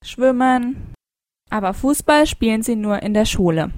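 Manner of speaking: ordinary talk, nothing marked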